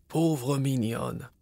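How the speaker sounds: put-on soft, slow tender old oak; talking tree. A narrator's reading. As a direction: gently pity the little insect. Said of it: "dit l'arbre".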